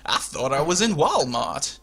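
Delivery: slow, badly faked American drawl; terrible American accent. Host joking about Walmart, in a bad American impression. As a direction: put on a clumsy American accent. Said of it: "never doing that, um, American impression again".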